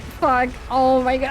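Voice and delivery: ordinary talk, nothing marked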